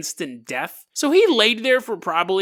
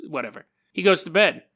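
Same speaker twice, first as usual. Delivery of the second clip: ordinary delivery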